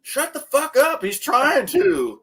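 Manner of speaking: high, laughing retold snap, slowing at the end; laughing through a mock telling-off. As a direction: tell them off while cracking up. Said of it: "I'm like".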